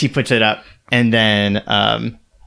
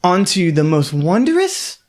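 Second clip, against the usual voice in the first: higher, cheerful sales patter; brighter auctioneer; 5 semitones higher than usual. Acted. Auctioneer perking up to present the next item. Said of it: "she goes".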